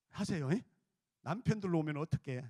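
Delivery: usual speaking voice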